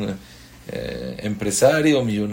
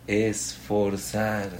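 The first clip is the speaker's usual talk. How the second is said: ordinary talk, nothing marked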